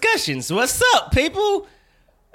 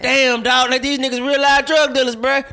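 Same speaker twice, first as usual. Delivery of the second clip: indignant rant; 5 semitones higher, 5 dB louder than usual. Real, not acted. Ranting about Starbucks overcharge.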